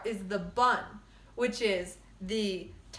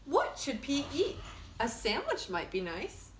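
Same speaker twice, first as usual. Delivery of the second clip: lively storybook read-aloud; bright picture-book narration; reading to children; 3 dB quieter than usual. Acted. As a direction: read it like story time for kids. Reads picture book to class.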